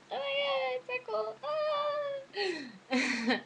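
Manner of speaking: high, squealing mock freakout over a freckle; mock panic. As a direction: shriek it like a tiny horror.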